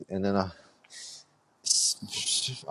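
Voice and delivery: ordinary talk, nothing marked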